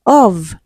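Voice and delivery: "of" ending in a buzzed v; "of" carefully sounded with vibration at the end; voiced final consonant. Shown as a demonstration. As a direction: say "of" alone, stressing the voiced v ending. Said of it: "however, is voiced".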